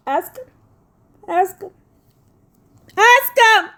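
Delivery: high insistent call rising into a shout; building shout. Real, not acted.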